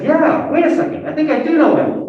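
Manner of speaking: imitating a stranger's excited double take; excited recognition. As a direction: retell the guy lighting up as it clicks. Stranger realizes he knows him.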